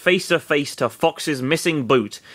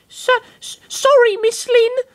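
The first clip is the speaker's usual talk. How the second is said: put-on high girl voice; 4 dB louder than usual. Florina voiced, shy.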